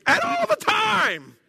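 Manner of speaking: high, drawn-out all-caps yell; mock shouting. Shown as a demonstration. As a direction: holler it slowly, every word stretched. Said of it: "it's like shouting".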